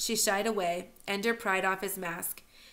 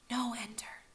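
soft unvoiced plea; whispered; 3 semitones higher, 6 dB quieter than usual. Sister stopping Ender from striking, soft whisper. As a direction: breathe it, barely there, holding him back. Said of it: "Valentine whispered".

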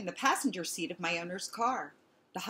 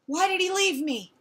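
high, louder cry of a left-behind dog; panted question; 10 semitones higher, 6 dB louder than usual. A narrator's reading. Abandoned dog after chasing the car, panted question. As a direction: ask it high and hurt, as if winded. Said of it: "I panted".

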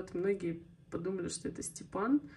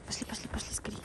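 whispering; 4 dB quieter, faster than usual. Real, not acted. Hurrying the dog.

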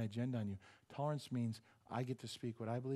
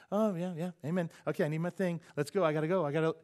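loud, raised, impatient excuses; hurried brush-off; 5 semitones higher, 7 dB louder than usual. Retold or imitated. Pastor in a hurry cuts off the old lady, hurried brush-off. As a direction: brush them off, itching to leave. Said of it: "But I was in a hurry".

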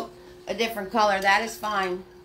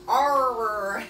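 pirate growl. Acted. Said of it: "I can't do that R sound".

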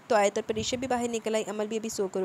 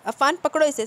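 loud, higher urgent command; urgent shout; 5 semitones higher, 8 dB louder than usual. Acted. Salar shouting to Afan to grab Adeel. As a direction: yell the order fast. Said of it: "Salaar चिल्लाया".

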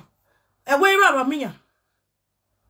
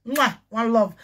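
ordinary talk

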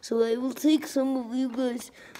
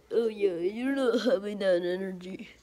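usual speaking voice

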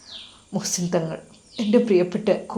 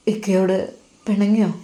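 plain reading voice

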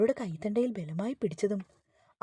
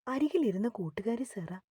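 ordinary delivery

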